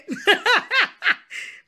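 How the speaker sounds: loud high giggle; high laughter. Real, not acted. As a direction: burst out laughing. Laughter burst.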